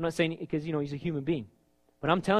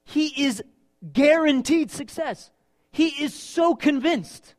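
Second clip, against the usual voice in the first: high, loud, slow emphasis; preaching at full force; 10 semitones higher, 7 dB louder, slower than usual. Acted. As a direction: drive home the certainty. Preaching climax.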